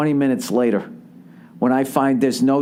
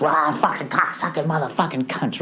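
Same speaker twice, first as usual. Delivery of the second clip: strained demonic outburst; inner demon; 2 semitones higher, 3 dB quieter than usual. Retold or imitated. Inner demon drowning out voice of reason, raging roar. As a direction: erupt like a cursing demon, voice distorted. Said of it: "the fucking demon in me".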